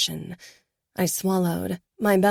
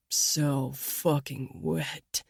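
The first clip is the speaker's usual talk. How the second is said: slow, soft, much lower growl; 4 semitones lower, 6 dB quieter, much slower than usual. A narrator's reading. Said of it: "Danilo growled".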